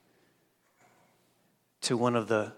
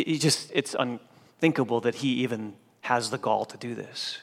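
normal talking voice

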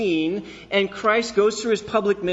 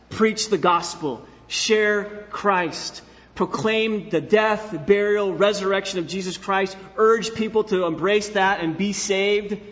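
slow, emphatic commands; fervent exhortation; 3 semitones higher, slower than usual. Acted. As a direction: preach each phrase as a call to act. Preacher exhorts the congregation.

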